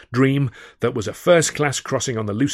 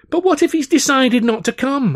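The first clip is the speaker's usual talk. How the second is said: 1 high agitated plea; 6 dB louder than usual. A narrator's reading. Arguing with Alf.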